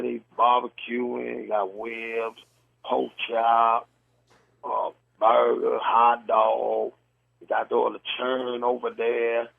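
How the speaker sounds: slow, drawled Southern country talk; imitasi logat Selatan. Shown as a demonstration. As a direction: mimic a Southern accent, stretching the words out. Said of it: "They'll say something like this".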